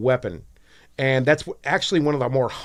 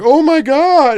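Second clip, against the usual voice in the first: much higher, louder hammed-up shriek of panic; mock panic; 12 semitones higher, 8 dB louder, much slower than usual. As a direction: ham it up like a cheap TV disaster movie. Acting panicked film character.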